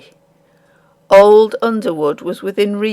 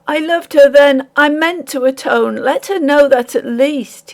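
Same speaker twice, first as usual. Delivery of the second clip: raised, loud pleading; desperate plea; 7 semitones higher, 4 dB louder than usual. Acted. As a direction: beg them desperately.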